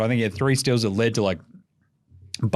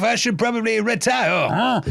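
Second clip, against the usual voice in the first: high, mocking imitation of a player; lightly voiced player joking at himself; 9 semitones higher than usual. Podcaster mimics a player mocking himself, mock high voice.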